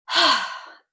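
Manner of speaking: big, loud "hah" sigh, falling; demonstrated sigh. Shown as a demonstration. Yoga teacher letting shoulders drop with students, big sigh. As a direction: release the breath in a heavy open sigh. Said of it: "exhale with a sigh".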